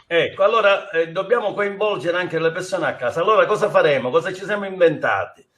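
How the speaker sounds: ordinary delivery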